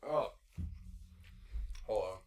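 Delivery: chewing, mouth full; pausing mid-bite of apple; biting an apple. Real, not acted. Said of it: "as he's chomping on an apple".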